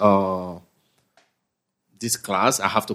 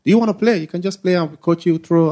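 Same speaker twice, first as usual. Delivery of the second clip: higher, louder and fast pitch to a recruit; eager coach; 6 semitones higher, 4 dB louder than usual. Retold or imitated. College coach recruiting a tall newcomer, eager pitch. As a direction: sell it hard like a keen coach.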